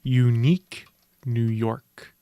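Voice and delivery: slow, deliberate tongue twister; vocal warm-up. Shown as a demonstration. Teasing the mumbling host with verbal exercises, slow and deliberate. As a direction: draw out "Unique New York" like a diction exercise. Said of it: "When you do some verbal exercises".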